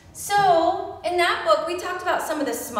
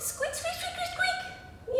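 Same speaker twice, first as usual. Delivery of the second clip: very high soft squeaking; tiny squeaks up high; mouse squeaks; 10 dB quieter, slower than usual. Pretend. Teacher squeaking like the mouse for children. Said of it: "he's a little mouse".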